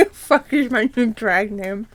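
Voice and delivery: slow laugh-through words. Real, not acted. Reader cracking up at own joke, laughing through words. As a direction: giggle it out.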